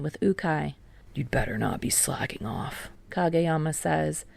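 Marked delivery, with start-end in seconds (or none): 1.1-2.9 hushed unvoiced warning; low whispered threat. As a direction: warn them under the breath. Captain warning teammates.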